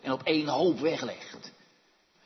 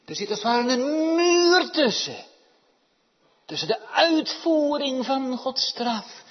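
loud, high, fast pulpit cry; louder, rising preacher's call; full-force preaching; 10 semitones higher, 11 dB louder than usual. Acted. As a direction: drive the point home to the congregation.

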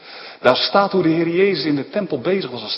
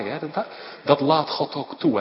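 ordinary delivery

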